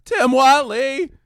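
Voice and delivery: very high, loud, slow shout; Jerry accusing Tim Whatley, shouting high and slow; mock Jerry rant.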